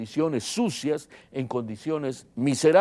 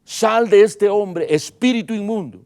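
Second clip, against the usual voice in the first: loud, high, stretched-out exorcism order; commanding; 7 semitones higher, 9 dB louder than usual. Retold or imitated. Preacher acting out Jesus expelling an unclean spirit, commanding shout. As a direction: thunder the command at the demon.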